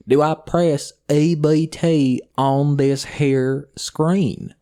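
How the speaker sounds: slow, high, loud mock Eastern Kentucky drawl; imitating a customer's heavy Eastern Kentucky drawl; thick Eastern Kentucky drawl. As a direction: drag every word out like the customer did. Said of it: "verbatim, that is what she said".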